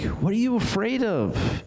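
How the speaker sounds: high, incredulous "What are you afraid of?"; a young boy's astonished challenge; amazed question. Retold or imitated. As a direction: disbelief at the frightened soldiers. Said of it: "And he's like".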